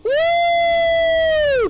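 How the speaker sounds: very loud, high cheer; victory whoop. Real, not acted. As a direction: let out a big whoop. Celebration cheer.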